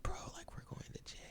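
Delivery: hushed, frightened whisper while hiding; scared whisper. Retold or imitated. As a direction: whisper it so the cops can't hear.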